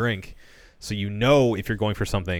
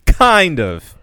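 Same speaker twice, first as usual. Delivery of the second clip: skeptical; 4 semitones higher, 7 dB louder, slower than usual. Real, not acted. Doubtful reply to co-host.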